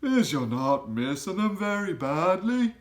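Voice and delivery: high, loud, odd giant voice with a rough edge of kindness; BFG giant voice. A narrator's reading. Giant asks Sophie if she is snug, high and loud. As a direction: voice the friendly giant fussing over the girl.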